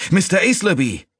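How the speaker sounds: high, loud urgent shout; calling out. A narrator's reading. Sneaton calling to his master near flames, high loud shout. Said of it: "rief Sneaton erneut".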